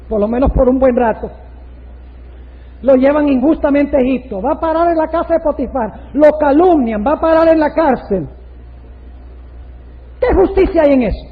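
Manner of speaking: loud rhetorical preaching; forceful preaching. Real, not acted. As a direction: pile up the injustices with force. Preaches Joseph's injustice forcefully.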